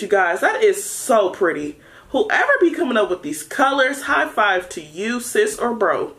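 ordinary delivery

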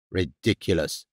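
soft, low-pitched growl of scorn. A narrator's reading. Father dismissing the detective's claim, low growl. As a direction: growl it under your breath, low. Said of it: "Mister Norman growled".

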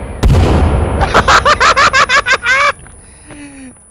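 high burst of laughter; loud laughter. Real, not acted. Laughing at chaos.

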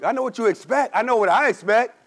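normal talking voice

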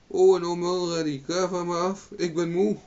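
high, loud exclamation all through; crane calling out. A narrator's reading. Tired crane orders Owen off mid-flight, high loud cry. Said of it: "maar halverwege riep ze".

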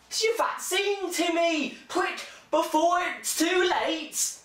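high, loud, slow urgent mother; urgent mum. Acted. A mother urging her son Timmy, high and loud. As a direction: hurry the child like a panicking mum.

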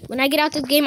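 ordinary delivery

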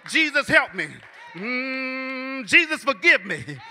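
rising, loud preaching cadence. Acted. Preacher urging congregation, loud rising sermon cadence. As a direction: preach it with building fire.

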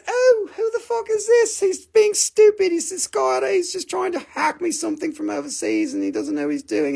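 high-pitched mock complaint; imitating a female friend. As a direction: mimic her high, easing down a little. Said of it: "My ally came and she said".

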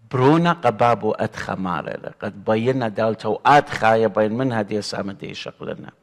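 ordinary delivery

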